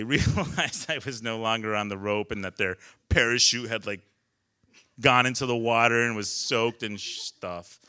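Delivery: normal talking voice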